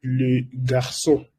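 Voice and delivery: le garçon said as French lø ɡaʁsɔ̃; French pronunciation. Shown as a demonstration. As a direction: say le garçon with a French nasal ending. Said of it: "Eso se pronuncia". (pronunciación francesa de le garçon)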